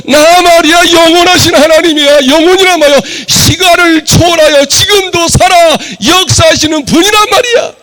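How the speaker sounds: a preacher lifting his voice toward a shout; fervent preaching. Acted. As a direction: build it up as God declaring himself. God's voice in sermon.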